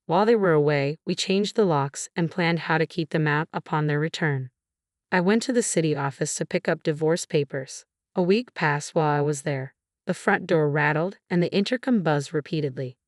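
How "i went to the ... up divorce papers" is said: plain reading voice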